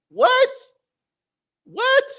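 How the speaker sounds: very high, loud "what?"; shocked squeak. Real, not acted. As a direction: yelp it in disbelief. Mock shocked reaction.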